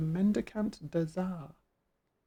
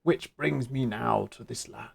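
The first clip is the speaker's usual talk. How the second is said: big burly beast man; 6 semitones lower than usual. Acted. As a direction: speak it like a huge hulking beast. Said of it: "Big burly beast man".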